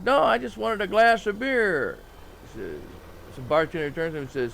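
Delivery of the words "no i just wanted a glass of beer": high, loud and slow protest; startled by the huge pitcher; surprised protest. Acted.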